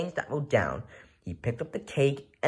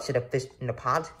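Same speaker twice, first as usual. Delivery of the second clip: usual speaking voice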